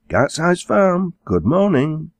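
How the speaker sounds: high, slow, sing-out phone greeting; telephone greeting. A narrator's reading. Girl answers farm telephone.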